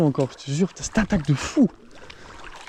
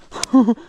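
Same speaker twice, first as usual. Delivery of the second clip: burst of laughter; 7 semitones higher, 6 dB louder than usual. Real, not acted. Relief after the fish.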